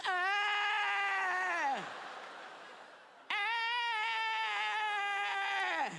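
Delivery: long, high mock moan. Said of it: "I just hear moaning".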